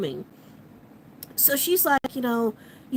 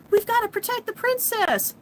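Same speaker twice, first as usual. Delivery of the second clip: urgent guardians; 10 semitones higher than usual. Retold or imitated. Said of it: "the inner guardians are like".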